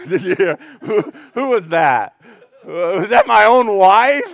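loud laughing question; amused disbelief. Real, not acted. Preacher caught off guard by his own wife, loud and laughing. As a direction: call it out through laughter.

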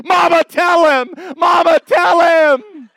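put-on little boy urging his mom to answer; excited boy shout. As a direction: shout it standing on the pew. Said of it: "stands up and says".

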